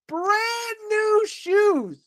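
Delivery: hammed-up tearful sob over brand new shoes; mock lament. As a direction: wail over the ruined shoes like a tragedy. Mourning friend's ruined new shoes.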